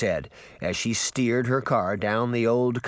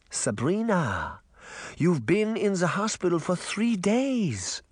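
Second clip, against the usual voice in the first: put-on grandmother with a light German accent, higher; 8 semitones higher than usual. Granny to Sabrina in hospital, light German accent. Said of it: "said in her light German accent".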